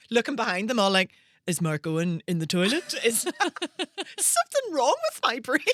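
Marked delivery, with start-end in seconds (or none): 1.5-5.6 laughing. Retold or imitated. Deluded search, mock self-doubt.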